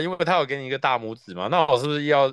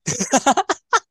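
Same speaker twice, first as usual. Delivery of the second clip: high burst of laughter; 13 semitones higher, 5 dB louder than usual. Real, not acted. Reacting to teasing banter.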